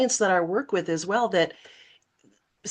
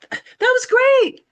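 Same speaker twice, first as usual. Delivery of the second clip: very high, overdone praise; forced laughing along; 13 semitones higher, 5 dB louder than usual. Retold or imitated. Fourth grader pretending to laugh with her teasers, high overbright cheering. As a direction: cheer them on with fake hilarity. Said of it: "I started laughing with them".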